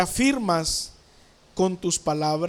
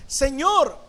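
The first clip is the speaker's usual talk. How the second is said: higher, mildly raised plea; acted cry for help; 5 semitones higher than usual. Preacher voices sinking Peter to Jesus, mild cry for help. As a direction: call out as if starting to sink. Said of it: "dio voces diciendo".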